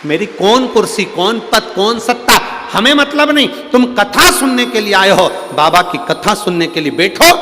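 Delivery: preacher urging listeners to drop their pride, loud and high; full-force preaching. Acted. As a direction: preach it out to the crowd.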